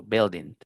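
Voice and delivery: building said "BIL-ding"; short I and silent U in building; building starting with "bil"; pronounced with I. Shown as a demonstration. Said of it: "con I de indio".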